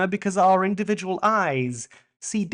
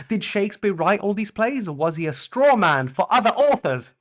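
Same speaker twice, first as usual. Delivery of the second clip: ordinary talk, nothing marked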